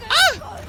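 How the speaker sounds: high, loud yelp of pain; pained scream. Real, not acted. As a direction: yelp as you get hit. Hit in game.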